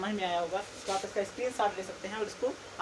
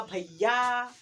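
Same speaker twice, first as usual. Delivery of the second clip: भैया with its final vowel held long; drawn-out call; 2 semitones higher, 4 dB louder, much slower than usual. Shown as a demonstration. Teacher repeating the far-off call for students, long and loud. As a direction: call out loudly, stretching the end. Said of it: "आखिरी में एक word आया जो बार बार repeat".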